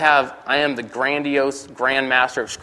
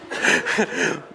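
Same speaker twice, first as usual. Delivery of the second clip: trailing laugh; 3 semitones higher, 3 dB quieter than usual. Real, not acted. Laughs at own story.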